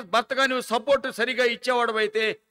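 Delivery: loud, high exhortation to husbands; preaching at full force. Acted. Preacher urging husbands to support wives, loud fervent preaching. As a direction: drive it home like a revival preacher.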